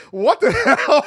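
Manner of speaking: laughing disbelief. Real, not acted. Shocked at gold blood.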